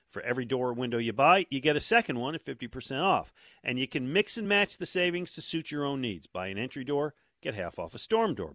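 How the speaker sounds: punchy radio ad read; infomercial. Acted. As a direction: sell it like a live commercial. Live ad read.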